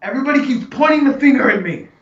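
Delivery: a hint of a high, whiny old man; lightly voiced old man insisting he is blameless; defensive old man. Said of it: "He's like".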